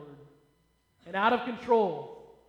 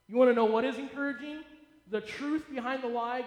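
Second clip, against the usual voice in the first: ordinary talk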